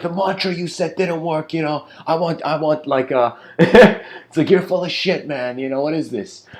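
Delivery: high, loud indignant rant; angry complaint. Retold or imitated. Angry disciple with a cast complains to his guru. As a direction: whine at the guru like you were cheated. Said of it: "he goes to the guru angry".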